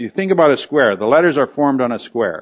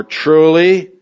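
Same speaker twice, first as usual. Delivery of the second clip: "truly" punched higher and louder; stress on truly; 4 semitones higher, 5 dB louder than usual. Shown as a demonstration. Pastor showing Jesus' emphatic word, stressed. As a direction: hit "truly" hard as the bold word. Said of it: "emphasis starts in the very first word".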